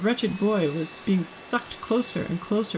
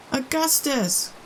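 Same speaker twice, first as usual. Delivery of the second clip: high drawn-out call across the river; 7 semitones higher, slower than usual. A narrator's reading. Mother calls her son from the riverbank, drawn out. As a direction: call him back from afar. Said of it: "called out Missus Gloop".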